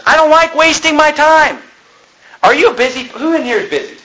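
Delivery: high-pitched, emphatic pulpit outcry; full-force preaching. Real, not acted. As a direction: cry it out to the congregation.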